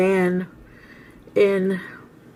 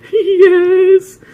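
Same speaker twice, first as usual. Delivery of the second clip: tearful wail; 11 semitones higher, 8 dB louder than usual. Retold or imitated. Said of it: "she's like".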